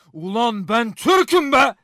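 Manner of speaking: loud, slow, swelling shout; defiant roar of pride; indignant boast. Acted. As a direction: build to a proud bellow. Indignant boast when challenged.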